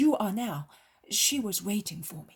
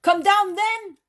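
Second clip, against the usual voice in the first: high, loud shout down the slope; exclaimed call; 13 semitones higher, 10 dB louder than usual. A narrator's reading. Peter calling the leper down from the olive tree, shouting high. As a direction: call out to her up in the trees. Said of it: "exclaims Peter".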